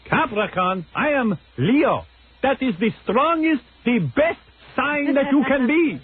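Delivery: put-on high, slow boastful Israeli accent; imitating an Israeli boss. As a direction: brag like the arrogant Israeli boss.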